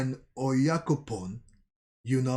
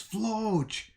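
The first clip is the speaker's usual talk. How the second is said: mild yell; 8 semitones higher than usual. A narrator's reading. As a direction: call the name out high, drawn out. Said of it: "someone yells".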